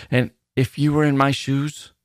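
stammered. A narrator's reading. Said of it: "I stammered".

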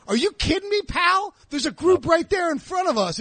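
high-pitched retold protest; indignant retort. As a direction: re-enact snapping back in disbelief.

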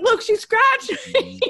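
mock him complaining like a hurt kid; whiny husband. Wife imitating her husband whining about the toddler, high and laughing. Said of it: "and he's like".

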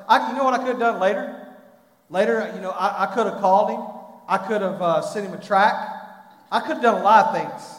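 loud, high, pleading altar-call preaching; urgent evangelist warning the congregation; preaching at full force. Acted. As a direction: drive it home like a revival preacher.

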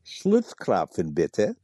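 Schlutzkrapfen, bitte, slow, in a mock romantic German accent; a playful German lilt on Schlutzkrapfen, bitte. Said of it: "Say it again in your most romantic German accent".

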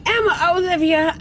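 high, loud fake delight; forced cheerful greeting. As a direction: greet a rival with loud false warmth.

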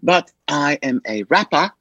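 imitated British accent, deliberate delivery; mock British rapper introducing herself; a daughter's put-on British voice. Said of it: "talking in a British accent".

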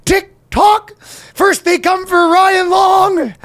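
high, slow taunting rant; high mocking voice. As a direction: taunt like a cartoon villain. High mock rant at TikTok.

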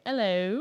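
"Hello" with the H taken away; dropped H. Shown as a demonstration. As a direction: say "Hello" as 'ello. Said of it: "taken the H away".

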